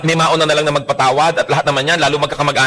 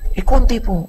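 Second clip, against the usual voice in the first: sheepish answer; 6 semitones higher than usual. Pretend. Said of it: "O baka naman, sumasagot ka".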